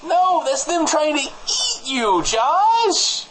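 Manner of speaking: exasperated. Acted. Exasperated correction of co-host.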